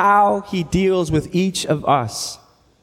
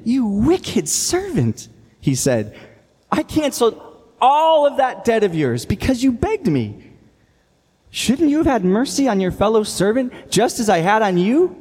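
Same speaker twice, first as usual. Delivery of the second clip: stern rebuke; 6 semitones higher than usual. A narrator's reading. Master rebukes wicked servant.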